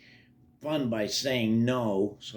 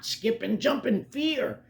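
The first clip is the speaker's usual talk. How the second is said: higher, lively narrator pitch; animated storytelling; 9 semitones higher than usual. A narrator's reading. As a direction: play up the jumpy fright. Narrator animates a scared hop.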